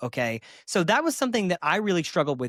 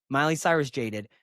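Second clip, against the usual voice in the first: normal talking voice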